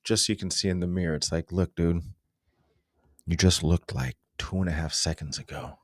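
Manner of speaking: usual speaking voice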